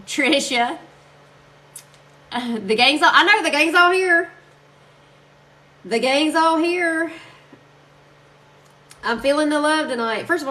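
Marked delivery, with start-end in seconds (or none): 2.6-4.3 excited greeting. Real, not acted. Greeting viewers joining. 5.8-7.1 excited greeting. Real, not acted. Repeated greeting, slowed.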